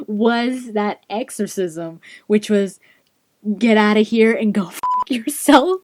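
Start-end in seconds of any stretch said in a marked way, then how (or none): 3.6-5.8 louder, breaking into laughter; laughing mock banishment; amused retold curse. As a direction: deliver the rude line and crack up. Quoting the rude exorcism, amused.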